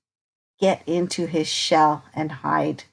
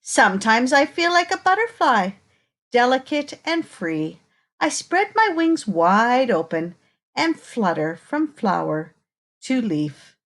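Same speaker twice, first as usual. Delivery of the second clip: put-on reading to children; 4 semitones higher than usual. Reading picture book to children.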